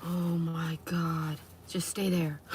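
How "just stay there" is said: ordinary delivery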